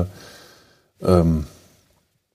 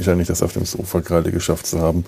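ordinary delivery

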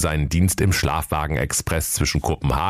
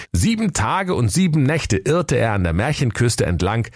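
ordinary talk, nothing marked

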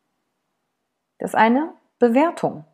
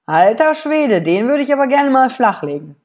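higher, louder cheeky exclamation; a cheeky, raised inner voice; lusty inner thought; 5 semitones higher, 4 dB louder than usual. Retold or imitated. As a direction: blurt it out like an impressed ogler. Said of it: "denk mir so".

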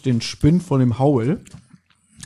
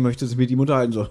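normal talking voice

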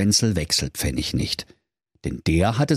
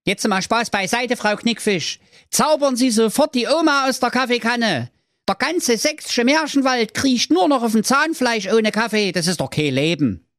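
high-pitched simpleton making demands; foolish Thorsten, complaining; 14 semitones higher than usual. Acted. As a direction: play an exasperated fairytale fool, pitched high. Acts Thorsten pleading with the fairy. (hohe Stimme des törichten Thorsten, empört)

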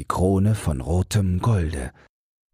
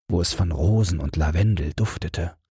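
ordinary delivery, nothing marked

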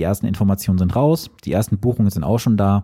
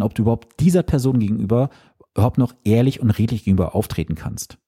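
ordinary talk, nothing marked

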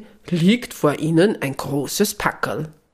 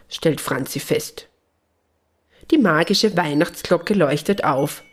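usual speaking voice

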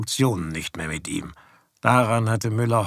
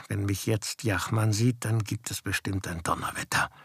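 quiet inner thought; 3 semitones lower, 6 dB quieter than usual. A narrator's reading. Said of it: "Er dachte nur bei sich".

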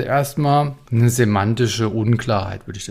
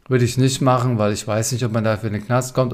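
usual speaking voice

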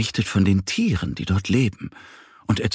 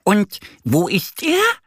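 much higher, eager duckling voice; curious duck; 13 semitones higher, 3 dB louder than usual. A narrator's reading. Annie asking the mice, eager duckling voice. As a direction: ask quickly, wanting to know. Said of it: "fragt Annie".

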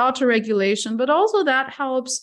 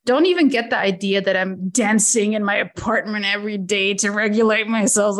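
normal talking voice

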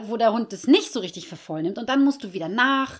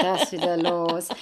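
weary dog; 4 semitones lower, slower than usual. Acted. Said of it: "Nala steht immer".

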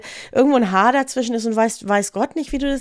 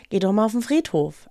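ordinary talk, nothing marked

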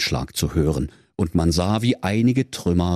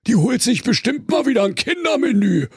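put-on high yelling teen bear; shrieking bear. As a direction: jeer at the witch. Bear jeers at witch.

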